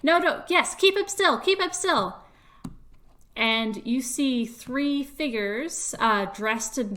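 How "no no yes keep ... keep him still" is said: high, loud urgent order; loud shout. Acted. The stranger yelling to hold the rabbit, high and loud. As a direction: yell it at the crowd to stop him. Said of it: "you hear the voice again saying".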